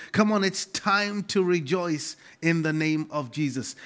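ordinary talk

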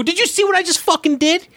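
retold protest, squeaking high with outrage; indignant retelling. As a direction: voice shoots way up in disbelief. Retold argument after his set.

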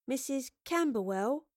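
unsure, drawn-out query; hesitant. A narrator's reading. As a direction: ask it uncertainly, rising at the end. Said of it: "Astrid asked hesitantly".

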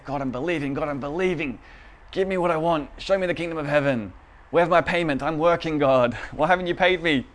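hammed-up entitled complaint to God; mock demanding prayer. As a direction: act out badgering God for what you're owed. Acting out demanding payment from God.

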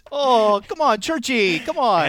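high, loud protest; exasperated plea. Acted. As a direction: call after a friend to relent. Protests as friend turns away.

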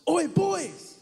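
loud high street call; shout. Retold or imitated. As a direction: call out sharply after two boys. Street guys shouting at them.

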